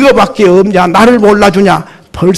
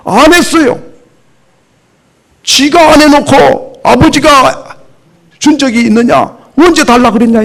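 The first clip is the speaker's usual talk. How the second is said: resentful elder son; 7 semitones higher, 4 dB louder than usual. Acted. Preacher voices the resentful elder son.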